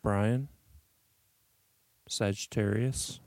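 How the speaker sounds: hushed public radio announcer; public radio host. Pretend. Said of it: "I'm trying to sound like I'm on public radio right now".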